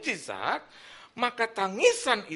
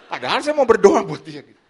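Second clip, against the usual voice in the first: emphatic loud protest; 13 dB louder than usual. Real, not acted. Preacher to congregation, loud emphatic protest. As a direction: burst out loudly, insisting he meant well.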